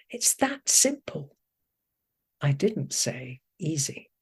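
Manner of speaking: unhurried reading of her own poem. Acted. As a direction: read each line like a verse, weighing the words. Reads her poem aloud.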